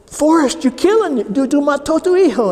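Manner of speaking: high, fast, alarmed mother scolding; frantic mother. Retold or imitated. Mother shouts at father that he killed their son, high fast alarm.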